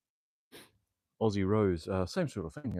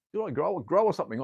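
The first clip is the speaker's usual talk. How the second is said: surprised outburst; 9 semitones higher, 8 dB louder than usual. Real, not acted. Surprised the rig is too low.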